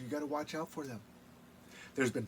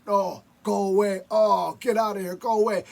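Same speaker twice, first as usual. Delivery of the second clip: shouting spirits; 6 semitones higher, 11 dB louder than usual. Acted. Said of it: "And still the people said".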